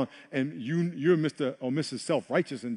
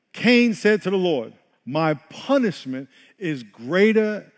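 louder, declaiming Genesis; forceful scripture reading; 3 semitones higher, 6 dB louder than usual. Acted. Pastor reading Cain's story to the church, loud and declaiming. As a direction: proclaim Cain's lament.

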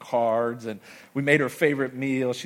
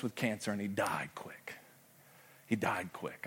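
whispered, soft and low; hushed whisper; 3 semitones lower, 8 dB quieter than usual. Real, not acted. Pastor whispering to the church about his mentor dying. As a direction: drop to a whisper in grief.

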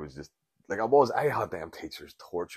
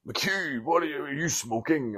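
mock outraged priest; 9 semitones higher than usual. Priest catches pupil smoking, acted.

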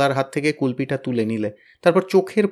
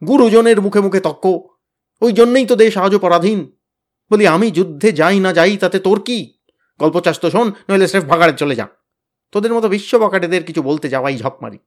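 higher, louder furious shout; 6 semitones higher, 7 dB louder than usual. A narrator's reading. Tenida shouts down Habul for talking back, furious. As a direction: bellow the scolding at the boys. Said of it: "চিৎকার করে বললে".

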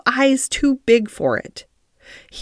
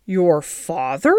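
slow, lower-pitched disbelieving question; stunned, slowly drawn-out question; astonished gasp; 4 semitones lower, slower than usual. A narrator's reading. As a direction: draw it out in astonishment. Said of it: "gasped Mary".

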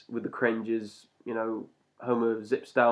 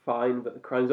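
normal talking voice

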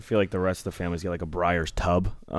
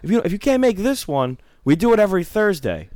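much higher, insistent pitch; hammed-up high church kid; mocking eager recruiter; 12 semitones higher, 3 dB louder than usual. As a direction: pitch way up and push the invite. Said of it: "And they're like".

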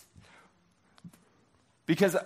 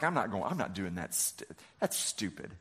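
muttered under the breath, softer and lower; sulky mutter; 9 semitones lower, 8 dB quieter than usual. Retold or imitated. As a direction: grumble it like a sulking man. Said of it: "went off in a rage. He's like".

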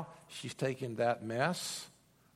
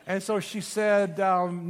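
normal talking voice

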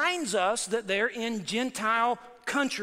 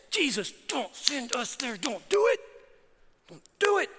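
begging demons; 3 semitones higher than usual. Retold or imitated. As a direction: beg harder with each line. Preacher voices demons begging Jesus.

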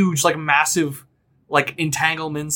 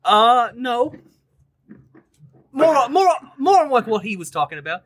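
boisterous captain; 4 semitones higher, faster than usual. Acted. GM greeting the new crew as a brash high-voiced captain. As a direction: bellow a welcome as a brash captain.

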